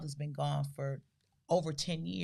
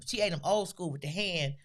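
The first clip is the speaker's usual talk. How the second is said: ordinary delivery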